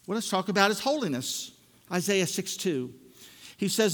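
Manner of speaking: normal talking voice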